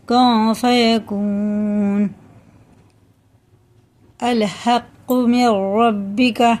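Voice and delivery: slow melodic chanted recitation; Quran recitation. Acted. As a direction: chant the verse slowly with long vowels.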